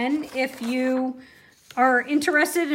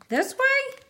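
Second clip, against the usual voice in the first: high questioning lilt; 13 semitones higher than usual. Real, not acted. Asking viewers which way round.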